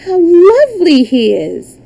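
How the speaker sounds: high, loud gush of admiration; delighted squeal of praise; admiring exclamation. A narrator's reading. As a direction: coo it out like a smitten little princess. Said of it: "one exclaimed".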